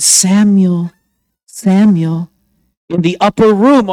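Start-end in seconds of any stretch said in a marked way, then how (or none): none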